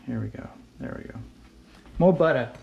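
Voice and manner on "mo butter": louder, higher punchy call; playful exclamation. Acted. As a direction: hype up the butter.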